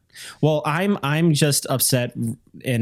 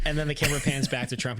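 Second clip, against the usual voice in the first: ordinary delivery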